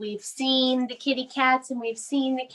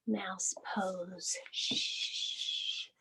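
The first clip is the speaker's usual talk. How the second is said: soft unvoiced whisper; 5 semitones lower, 13 dB quieter, slower than usual. Real, not acted. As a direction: hush like a tiny mouse. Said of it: "You have to be very quiet".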